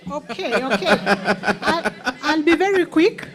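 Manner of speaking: words through nervous laughter; laughing. Real, not acted. Laughs while reading out.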